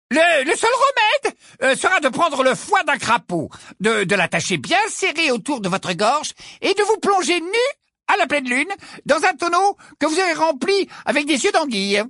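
put-on high-pitched old-time quack diagnosing with relish; portrait of a medieval wizard healer, insistent. Portrait healer tells Ron of a grave skin disease, high and insistent. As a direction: pronounce a dire diagnosis in a high, loud character voice.

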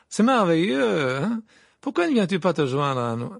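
loud, affected delight, drawing words out; gushing journalist. A narrator's reading. Rita invites Harry to join her, gushing. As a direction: coo an invitation with a big smile. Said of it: "avec un grand sourire".

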